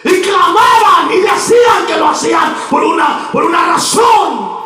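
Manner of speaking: high shouted exhortation, key words stretched out; rally the church with a crying-out call; full-force preaching. Real, not acted. (predicación exaltada, clamando a voz en cuello)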